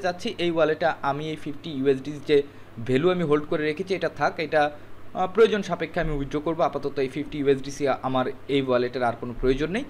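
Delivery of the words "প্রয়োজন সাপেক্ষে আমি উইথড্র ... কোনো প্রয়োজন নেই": ordinary talk, nothing marked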